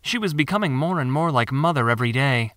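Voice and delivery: plain reading voice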